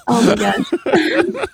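slow, high laughing exclamation. Real, not acted. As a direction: laugh through the words. Laughing at the military joke.

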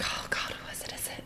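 hushed whisper, half asleep. Retold or imitated. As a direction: whisper it as if woken by a late call. Woken by 3 AM call.

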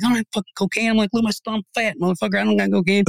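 mock outrage at the accusation; indignant protest. Protesting to the cops.

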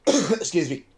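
coughing, tea down the wrong way; spluttering after swallowing tea; choking on a drink. Real, not acted. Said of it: "I drank a bit of tea there and it, it went down the wrong way".

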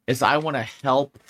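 ordinary delivery

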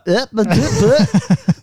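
a hint of rusty stammer, slow; fumbling for words. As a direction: stumble like someone who forgot how to talk. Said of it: "you forget how to talk England".

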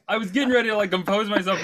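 ordinary delivery

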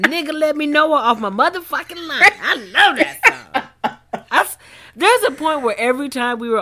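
loud, high, excited laughing. Real, not acted. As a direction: belt it out with glee. Quoting favorite song line.

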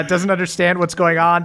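normal talking voice

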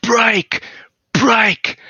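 sharp mock shout to halt the band; Tricky. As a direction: call it out high, twice, with a gap. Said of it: "And Tricky screams out".

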